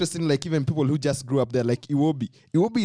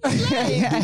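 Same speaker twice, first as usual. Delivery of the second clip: usual speaking voice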